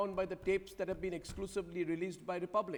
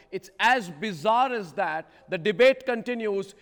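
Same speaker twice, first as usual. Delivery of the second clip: emphatic; 4 semitones higher, 11 dB louder than usual. Real, not acted. Anchor building to a climax.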